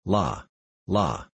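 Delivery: mild cry. A narrator's reading. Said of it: "she cried".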